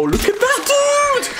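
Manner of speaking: high, drawn-out amazed yell. Real, not acted. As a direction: whoop in amazement at the play. Amazed reaction to gameplay.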